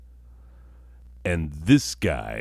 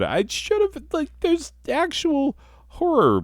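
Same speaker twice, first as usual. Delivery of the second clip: much higher, whiny self-reproach; squeaky regret. Real, not acted. Comedian whining about his bad horror story, high. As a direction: wail at your own failed story.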